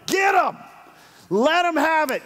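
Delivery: high, punchy cheer-on yell; egging on. Retold or imitated. Pastor eggs on his golfing friend, high excited yell.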